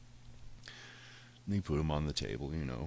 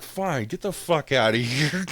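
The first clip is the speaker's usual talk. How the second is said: higher, louder, slow fed-up shout; exasperated yell; 10 semitones higher, 10 dB louder, slower than usual. Retold or imitated. Owner giving in to the cat, fed-up shout. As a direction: give up and shout him out.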